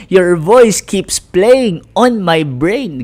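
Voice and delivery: slow, drawn-out mock complaint; a slow, teasing take on the child complaining about the repeated voice; quoting an annoyed student. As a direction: lightly act out the kid's gripe, slowly. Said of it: "sabi niya".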